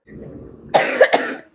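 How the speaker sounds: hard, rattling cough; loud smoker's cough. Acted. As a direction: hack it out loud.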